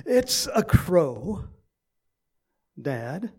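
mildly exasperated, slow and drawn out; irritated son. Retold or imitated. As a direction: imitate a son losing patience with his dad. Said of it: "a little bit more irritated".

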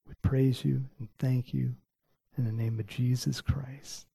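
soft, earnest prayer voice, partly whispered. Real, not acted. Preacher praying softly over the congregation after the sermon. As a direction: pray gently over the congregation.

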